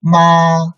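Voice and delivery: Ma held high and flat; first tone. Shown as a demonstration. Teacher models Ma for repetition, high flat first tone. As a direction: sustain Ma on a high, level pitch. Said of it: "Okay, read again".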